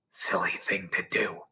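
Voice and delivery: soft disapproving whisper. Acted. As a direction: whisper it behind your hand. Gossiping aside between girls.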